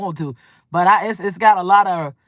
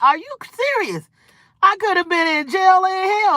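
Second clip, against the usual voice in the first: high, disbelieving outburst, much higher; incredulous; 10 semitones higher than usual. Retold or imitated. To the one who enjoyed her temper, high and incredulous. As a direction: ask it like you can't believe your ears. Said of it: "I was like".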